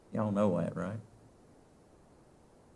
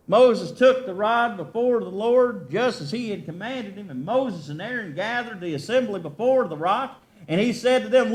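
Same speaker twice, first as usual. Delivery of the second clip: raised, fervent scripture reading; preaching scripture; 7 semitones higher, 4 dB louder than usual. Acted. Preacher reading the story of the rock to the church, fervent. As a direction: build the story up for the people.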